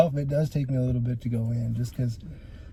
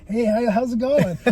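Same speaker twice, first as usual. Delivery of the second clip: higher, laughing hey how's it going; laughing greeting; 7 semitones higher than usual. Retold or imitated. To the man with a shotgun, laughing nervously. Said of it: "I was super calm".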